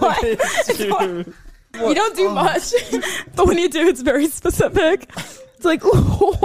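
high, laughing speech; talking through giggles. Real, not acted. Laughing while explaining.

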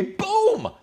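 loud, high "pum" boom; explosive crash sound effect; imitated crash. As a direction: burst out the impact noise.

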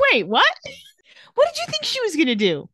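a hammed-up Stefan, caught off guard and rushing; shocked disbelief. Recapping Stefan seeing the knife, mock shocked.

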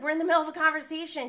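impatient retort. Retold or imitated. Recapper mimics Lala cutting her off, fast and annoyed. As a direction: rattle it off, annoyed and quick.

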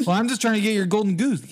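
high, quick mock character voice; fairy-tale intruder. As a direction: plead your case in a hammed-up high voice.

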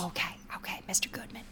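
soft whisper to placate; hushed compliance. Pretend. Server quietly gives in to Mister Goodman, whispering. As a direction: agree under your breath. Server secretly agrees with star.

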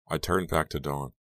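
ordinary delivery

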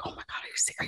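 hushed, stunned whisper of disbelief; disbelieving whisper. Retold or imitated. Finding the fake account, she whispers in disbelief. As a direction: whisper it like you can't believe what you're seeing. Retelling her shock at discovery.

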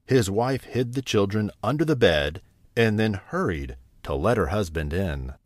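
ordinary delivery

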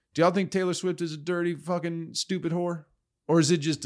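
quick, high provocation; fast heated question. Real, not acted. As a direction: fire it off high. Asking audience, heated.

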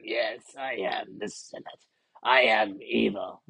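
deep, slow, sinister Emperor voice; Palpatine impression. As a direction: growl it low like an evil Sith lord. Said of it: "do a great impression of this guy".

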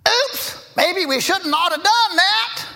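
high, slow sheepish oops voice; mock oops. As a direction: play the caught-out experts. Mocking experts' admission.

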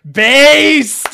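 loud, high "Based!" cheer; hyped shout. Real, not acted. Cheering a comic panel with a loud, high yell. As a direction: yell it like a fan in the chat.

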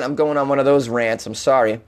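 ordinary delivery

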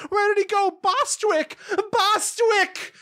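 very high mock screech, girlish; screaming old ladies. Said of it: "Screaming and running around, but acting like little girls".

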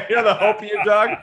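high, giddy and loud celebrating; mock excited. Salesperson bragging to his wife, high and giddy. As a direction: ham up the thrill of the big call.

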